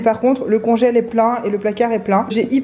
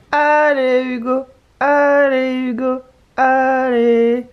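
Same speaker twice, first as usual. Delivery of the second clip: slow, louder chant of encouragement; cheering; 3 semitones higher, 4 dB louder, much slower than usual. Real, not acted. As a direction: cheer him on like a fan. Cheering boyfriend on from bed.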